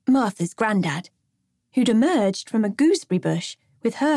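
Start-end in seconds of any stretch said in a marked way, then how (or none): none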